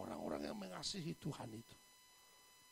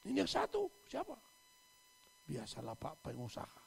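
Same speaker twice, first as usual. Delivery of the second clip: normal talking voice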